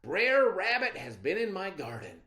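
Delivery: furious at the thief; angry farmer. A narrator's reading. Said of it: "roared Mister Man".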